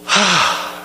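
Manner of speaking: long relieved exhale; sigh of relief. Acted. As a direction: let the worry go with a sigh. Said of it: "What a relief".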